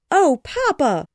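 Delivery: high, loud joyful cry; delighted exclaim. A narrator's reading. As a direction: burst out in delight. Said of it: "exclaimed all at the same time".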